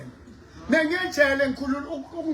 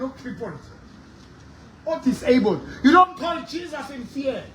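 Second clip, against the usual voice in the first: ordinary talk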